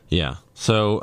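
ordinary talk

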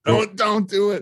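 inner voice. Acted. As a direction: plead with yourself, pitch jumping up. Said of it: "the voice in my head just said".

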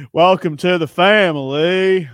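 drawn-out put-on Southern welcome; mock Southern accent. Host teases guest about Brian Kelly, in a slow mock Southern accent. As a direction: stretch it slowly, hammed-up Southern.

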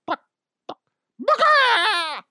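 clucks building to a loud, high squawk; small clucks, then a screeching chicken cry; ghost chicken squawk. Pretend. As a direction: erupt from clucking into a shrieked squawk.

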